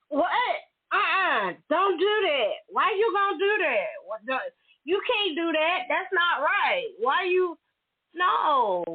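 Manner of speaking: playing the guy squealing in protest; mock outraged guy. Imitating the sender protesting his pic being shared, mock outraged.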